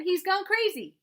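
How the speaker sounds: high, loud panicked shout. A narrator's reading. Boy yelling to Mama that he can't hold the goose, high loud shout. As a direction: yell over the honking goose. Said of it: "I shouted".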